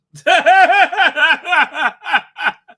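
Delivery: loud, high cackling laughter; real laugh. Real, not acted. Host cracks up at the John Cleese anecdote, loud laughing. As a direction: burst out in a long loud laugh.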